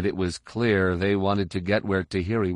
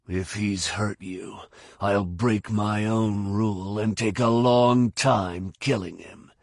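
slow, menacing character voice; Fett; slower than usual. Acted. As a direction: threaten slowly and deliberately. Fett threatens whoever hurt Mirta.

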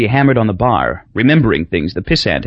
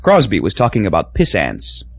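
ordinary delivery

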